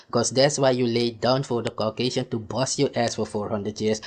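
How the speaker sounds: ordinary talk, nothing marked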